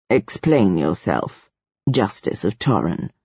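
very low, slow demand; deep, deliberate command; imperious ruler. A narrator's reading. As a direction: demand it gravely without raising the voice.

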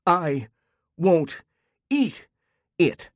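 high, punched words with pauses between; tantrum. A narrator's reading. Child refusing food, a high tantrum, word by word. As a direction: dig in word by word like a stubborn child. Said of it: "just like how Marty threw tantrums".

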